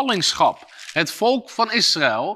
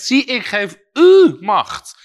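"Zie, ik geef u macht" slow and much louder, punching "u"; bold loud declaration; 6 semitones higher, 7 dB louder, slower than usual. Shown as a demonstration. Preacher showing the congregation how to declare it, slow and loud. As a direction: proclaim it with authority over the enemy. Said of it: "Jij zegt".

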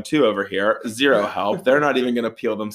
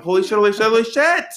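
panicked; 9 semitones higher, 4 dB louder, faster than usual. Retold or imitated. Panicked quote as guy sprints.

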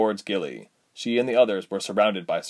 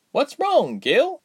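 put-on young heroine voice; 4 dB louder, much slower than usual. Reshma to sister.

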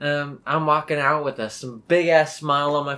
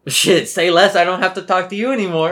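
relieved outburst; 7 semitones higher, 9 dB louder than usual. Retold or imitated. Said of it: "And I'm like".

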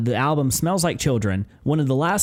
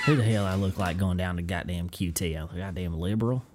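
lower, softer put-on Southern grumble; Southern accent; 6 semitones lower, 6 dB quieter than usual. Host scorning a gas station, put-on Southern accent. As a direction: grumble as an offended country boy, Southern. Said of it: "start leaning into the Southern accent".

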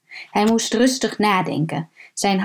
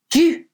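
loud, higher retching yelp; gagging cry; 8 semitones higher, 4 dB louder than usual. Acted. Old man seeing a body in the pit, loud retching yelp. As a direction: gag in shock and disgust.

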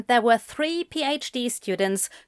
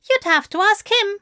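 prim higher voice; 11 semitones higher, 5 dB louder than usual. A narrator's reading. Aziraphale voice.

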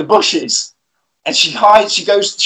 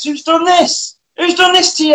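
shocked; 10 semitones higher than usual. Retold or imitated. Lauren shocked at Carl's face.

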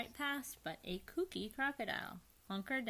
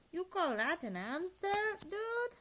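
mocking; 12 semitones higher, 7 dB louder, slower than usual. Don Diego mocks him.

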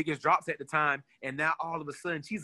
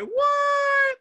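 much higher and louder, a squeaky cry of shock; shocked shout; 6 dB louder than usual. Retold or imitated. Both friends hear she was in Harry Potter, so they shriek in shock. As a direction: shriek it in total disbelief. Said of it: "both of them was like".

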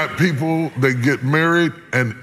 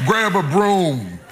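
slow command; 6 semitones higher, slower than usual. Acted. Slow commanding preach line.

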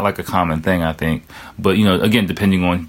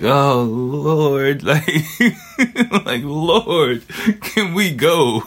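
weary; 7 semitones higher, much slower than usual. Retold or imitated. His own weary retold reply.

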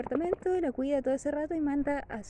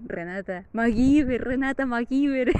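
disappointed; 8 dB louder, slower than usual. Retold or imitated. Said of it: "así decepcionado, súper, súper decepcionado".

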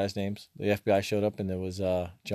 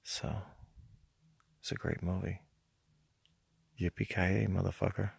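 whispering; 3 semitones lower, 9 dB quieter than usual. Acted. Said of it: "Why are you whispering?".

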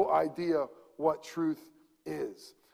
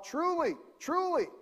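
elders calling out; 12 semitones higher than usual. Retold or imitated. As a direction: call it out like a synagogue elder. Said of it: "the elders of the church would yell out".

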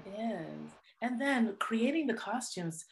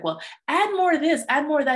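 higher, louder, bossy teacher voice; 7 semitones higher, 5 dB louder, faster than usual. Retold or imitated. Teacher correcting a child's art, mimicked higher and louder. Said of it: "And the teacher was like".

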